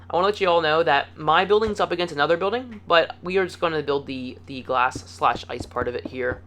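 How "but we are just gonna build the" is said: ordinary talk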